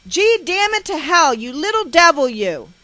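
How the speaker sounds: high, loud exasperated yell; enraged outburst falling on the last word; furious cursing. A narrator's reading. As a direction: curse the child at full volume. Mother's rage during the beating.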